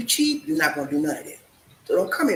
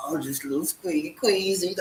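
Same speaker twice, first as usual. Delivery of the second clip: normal talking voice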